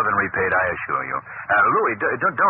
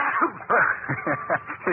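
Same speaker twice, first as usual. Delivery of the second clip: a sudden high burst of laughter; startled laugh at a sudden shout; 8 semitones higher than usual. Acted. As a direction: laugh out in surprise.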